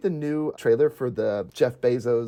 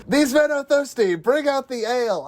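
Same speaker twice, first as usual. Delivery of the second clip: hammed-up Boromir; 11 semitones higher than usual. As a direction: proclaim it like a feasting warrior.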